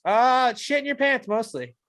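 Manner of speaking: high, slow put-on reply; lightly voiced podcast fan; imagined fan answering. Imagined answer about their podcast.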